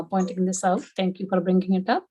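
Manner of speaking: ordinary talk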